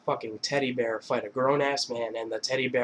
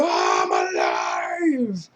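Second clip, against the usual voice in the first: long drawn-out mock scream; Jonah Hill; 11 semitones lower, much slower than usual. Man jolted back by needle in chest, drawn-out mock scream. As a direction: stretch a revived gasp into a slow shout.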